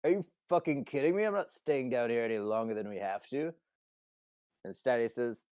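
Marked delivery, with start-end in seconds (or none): 0.0-3.5 high, loud and fast, then easing; exasperated protest. Acted. Simon arguing with Steiny over the grate, exasperated. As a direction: burst out in annoyed disbelief.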